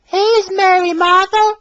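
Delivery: put-on high puzzled young engine voice; high, loud, baffled question; puzzled Molly. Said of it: "asked Molly".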